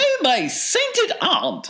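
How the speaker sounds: very high, drawn-out falsetto exclamation; Wonka's aghast cry. A narrator's reading. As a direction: cry out in a slow, piping character voice. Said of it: "cried Mister Wonka".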